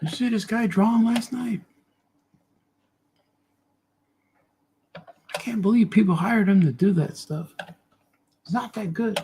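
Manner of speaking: high-pitched put-down voice; heckling critic. Acted. Critic runs down the artist's drawing to others, high heckling voice. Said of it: "hope that critic leaves me alone".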